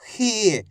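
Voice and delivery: खी with a long, held ee; drawn-out vowel. Shown as a demonstration. Teacher showing students the long matra, खी drawn out. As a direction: stretch the ee in खी. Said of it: "इसका उच्चारण खींच के".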